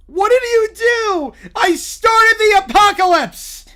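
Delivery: high, loud, slow yells; shouted confrontation. Acted. Friend and Bear shouting about the apocalypse, loud and slow. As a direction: scream the accusation and answer.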